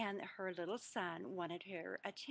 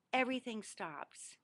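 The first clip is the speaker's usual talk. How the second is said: normal talking voice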